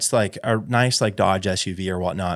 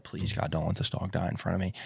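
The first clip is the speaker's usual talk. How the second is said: much softer pleading prayer; hushed prayer; 5 semitones lower, 11 dB quieter than usual. Retold or imitated. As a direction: pray under your breath. Praying dog won't die.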